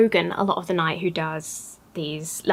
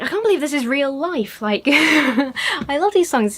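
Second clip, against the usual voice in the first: raised, gushing retold amazement; thrilled disbelief; 6 semitones higher, slower than usual. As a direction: relive being starstruck, with a laugh. Reliving her disbelief at the gig.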